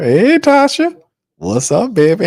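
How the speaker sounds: high, bright call to Tasha; excited greeting. Real, not acted. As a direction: sing out her name in welcome.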